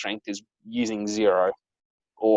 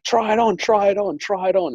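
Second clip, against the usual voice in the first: high, louder imitation of an eager child; eager urging; 10 semitones higher, 4 dB louder than usual. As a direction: pester mum like an excited sister. Sister urges mum in story.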